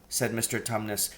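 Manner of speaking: ordinary delivery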